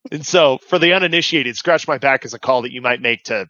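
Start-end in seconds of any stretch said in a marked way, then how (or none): none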